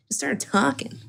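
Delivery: ordinary delivery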